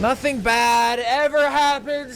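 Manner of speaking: high, slow mock-cheerful yell; sneering shout of fake praise; sarcastic shout. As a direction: belt the sarcasm out, dragging it. Sarcastic jab at the spooky factory.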